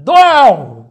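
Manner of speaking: imitating a child's high, emphatic no; very high, punched-out refusal; firm refusal. Trained child refusing any offer, forcefully.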